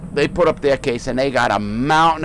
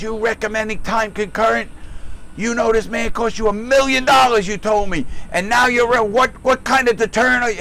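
a higher, mock-angry judge grilling the prosecutor; retold judge yelling; 8 semitones higher than usual. Retelling how the federal judge tore into the prosecutor, imitated angry yelling. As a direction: scold like a judge who can't believe it. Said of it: "And he's yelling at the".

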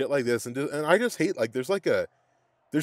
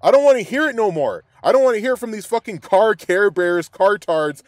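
loud, higher ranting; angry yell; 7 semitones higher, 9 dB louder than usual. Real, not acted. Fed-up fan yelling at critics online, loud rant. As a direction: shout it like you're fed up.

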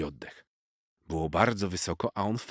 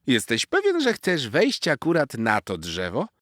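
much higher, louder squirrel voice pushing the question; squirrel pressing; 7 semitones higher, 5 dB louder than usual. A narrator's reading. Squirrel warning the cat, high squeaky voice. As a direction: press him again, squeaky and insistent. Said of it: "spytała wiewiórka Zosia".

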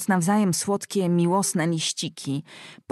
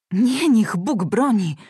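louder, slowed-down protest; exclaimed; 4 semitones higher, 6 dB louder, slower than usual. A narrator's reading. Cecylia denying her son is chosen, exclaiming loudly. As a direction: cry out to ward it off. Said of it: "wykrzyknęła Cecylia".

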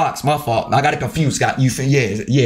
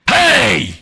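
sudden shout; 8 semitones higher, 3 dB louder than usual. Real, not acted. Reacting to game play.

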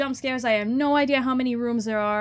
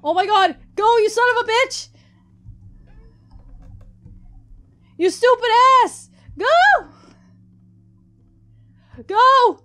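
high, loud panicked yells; frantic yelling; 12 semitones higher, 11 dB louder than usual. Real, not acted. Player yelling at own character to run.

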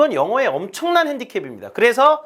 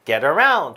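"around" swelled on its "round" syllable; light "Get", weighty falling "around"; stress on "around"; 2 semitones lower, 4 dB louder, much slower than usual. Shown as a demonstration. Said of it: "특히 여기 round이 강세예요".

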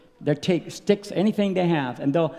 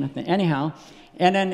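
ordinary talk, nothing marked

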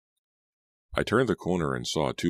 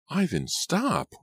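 much higher, drawn-out shriek; 12 semitones higher, much slower than usual. A narrator's reading. Martha shrieking at Ivan mid-fight. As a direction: scream at him to stop. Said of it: "I shrieked".

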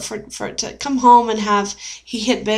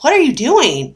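higher, imitating an alarmed husband; mildly panicked; 6 semitones higher, 4 dB louder than usual. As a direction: retell his startled question, pitched up. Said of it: "he's, like, kinda panicking".

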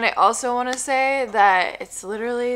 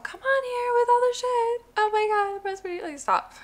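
mocking high voice; 14 semitones higher than usual. Mocking critics of Botox.

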